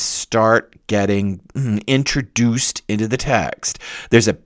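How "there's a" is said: ordinary talk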